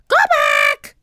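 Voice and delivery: very high, loud parrot screech; shrieking parrot warning; squawking parrot. A narrator's reading. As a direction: screech it like a bird. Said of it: "squawked Polly".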